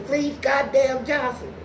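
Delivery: high, loud, slow name shout; defiant answer. Retold or imitated. Newcomer answering the inmate's challenge, loud slow defiant. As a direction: bark your full name out.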